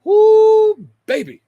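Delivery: loud high woo of delight; excited whoop. Real, not acted. Streamer whooping to chat, loud and high. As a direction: let out a big joyful woo.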